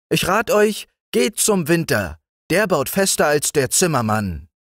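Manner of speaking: sehr hohes, feines Stimmchen; Spinnenstimme. A narrator's reading. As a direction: als winzige Spinne antworten. (Spinne antwortet den Kindern mit sehr hohem Stimmchen)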